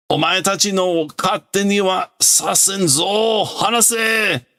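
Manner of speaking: put-on high, shouting old man claiming his land; hammed-up villain tantrum; raging old villain. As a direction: bellow ownership at the robots.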